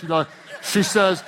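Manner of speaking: ordinary talk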